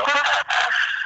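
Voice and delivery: high breathless mock laugh; imitating an ignorant laugh. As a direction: mimic a dismissive snicker. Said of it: "that kind of ignorant laugh".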